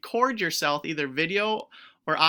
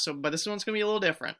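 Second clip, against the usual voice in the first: normal talking voice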